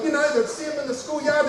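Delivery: ordinary delivery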